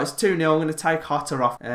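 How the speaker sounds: ordinary delivery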